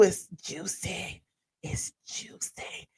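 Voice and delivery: slow gossipy whisper; juicy whisper. Real, not acted. Teasing gossip.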